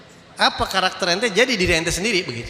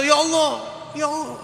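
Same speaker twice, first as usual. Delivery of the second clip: high, raised calls of the name; crying out; 8 semitones higher than usual. Retold or imitated. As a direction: cry out high to heaven. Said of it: "teriak-teriak".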